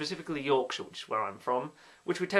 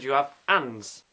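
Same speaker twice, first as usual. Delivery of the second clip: ands with no H, slowed; slow deliberate ands, H dropped; Yorkshire accent; 4 dB louder, slower than usual. Shown as a demonstration. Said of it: "dropped H demo".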